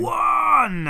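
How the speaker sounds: loud, high victory cry; triumphant shout. Real, not acted. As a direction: yell it like you won. Celebrating a correct guess.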